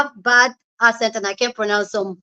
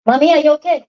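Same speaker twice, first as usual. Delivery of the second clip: calling out to dog; 5 semitones higher, 5 dB louder, much faster than usual. Real, not acted.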